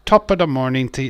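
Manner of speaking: stage Irish greeting, pushed and bright. Shown as a demonstration. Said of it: "all stereotypical Irish things".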